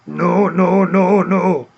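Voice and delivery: imitated rumbly African-accented refusal; Dikembe Mutombo. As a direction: mimic a famous shot-blocker, rounded drawn-out vowels. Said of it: "copying Dikembe Mutombo's famous".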